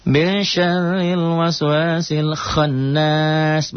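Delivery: high, slow chanted recitation; Quran recitation demo. Shown as a demonstration. Teacher chanting the verse for students, slow and high. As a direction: draw out the Arabic like a reciting teacher. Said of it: "Mad ada disukun".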